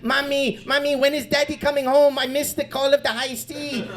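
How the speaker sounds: lightly voiced whiny kid; a sad little boy asking for his dad; pirate orphan child.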